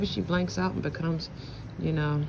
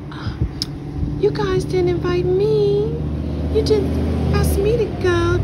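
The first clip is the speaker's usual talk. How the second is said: whiny mother impression; 7 dB louder than usual. Narrator mocks mother complaining about not being invited, whiny high voice. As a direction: whine like a slighted mother. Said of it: "she'll say things like".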